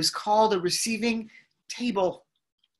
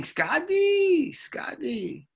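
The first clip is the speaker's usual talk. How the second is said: high call that drops away; calling from heaven; 10 semitones higher, much slower than usual. Retold or imitated. Aunt calling from heaven, high then softening. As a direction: summon him from far above. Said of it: "I hear her calling to me from heaven".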